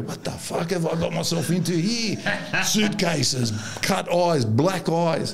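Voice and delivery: high-pitched incredulous coach; Eurocalo exasperated. Retold or imitated. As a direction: play the coach aghast at what he's landed in. Voices the coach's exasperated thoughts.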